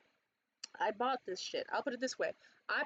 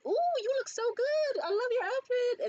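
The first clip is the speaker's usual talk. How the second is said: high, louder delighted gushing; an Indian mom gushing over a stranger's look, high and delighted; gushing compliment; 5 dB louder than usual. Retold or imitated. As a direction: coo over her outfit like an excited auntie. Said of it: "They're like".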